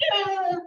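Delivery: two high grunts on the hit; tennis grunt. Retold or imitated. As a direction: grunt twice like hitting a ball. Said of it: "they develop grunts".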